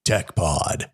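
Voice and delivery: slow epic announcer read; movie trailer voice. Pretend. Continues trailer voice.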